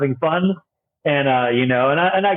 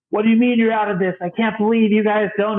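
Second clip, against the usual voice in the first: higher, hammed-up complaining customer; mock angry customer; 8 semitones higher than usual. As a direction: play a shopper annoyed something sold out. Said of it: "So you get yelling".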